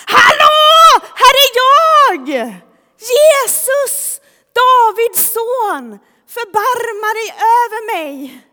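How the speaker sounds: very loud, high, desperate yell. Acted. Blind man begging Jesus for mercy, shouting. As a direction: cry out at full voice to be heard. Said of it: "att han ropar ännu högre".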